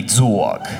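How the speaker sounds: dog said low as dawg; dog with a heavy New York aw. Shown as a demonstration. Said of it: "T D A W G".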